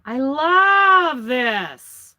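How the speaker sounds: long drawn-out, loud adoration; swooning, very slow and emphatic; gushing love. Real, not acted. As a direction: stretch it slowly with delight. Gushing over a gift.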